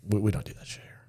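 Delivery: hushed, breathy whisper. Retold or imitated. Guy hushing a newcomer in the barracks, whispered. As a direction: whisper it like a secret.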